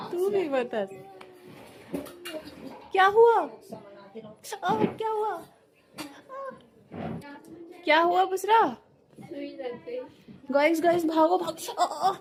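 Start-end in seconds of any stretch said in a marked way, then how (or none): 2.9-8.8 mother asks anxiously what happened; alarmed. Real, not acted. Daughter cries out, needle. 10.5-12.1 high, laughing pained cries; real startled laughter; laughing yelp. Real, not acted. Pricked by needle, laughing.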